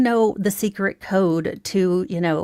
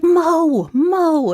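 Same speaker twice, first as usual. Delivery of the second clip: high, loud eager calling of a name; excited calling; 9 semitones higher, 5 dB louder than usual. Retold or imitated. Coworker rushing up to Mo, high excited calling. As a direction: call out like you found a treasure.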